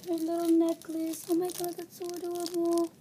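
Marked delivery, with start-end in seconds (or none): none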